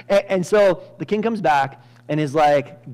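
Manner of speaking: ordinary talk, nothing marked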